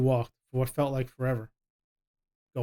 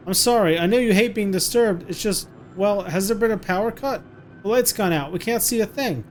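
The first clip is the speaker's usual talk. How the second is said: high, loud anxious apology and plea; pretend panicked pleading; 6 semitones higher, 4 dB louder than usual. Mother pleads with the captor about the dark, loud mock panic. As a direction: sell the fake blackout, frightened and urgent.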